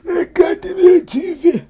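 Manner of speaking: put-on comic Jamaican accent of an aging ex-player; old Jamaican man voice. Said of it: "the old Jamaican man voice".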